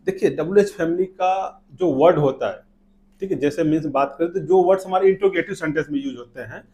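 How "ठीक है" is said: normal talking voice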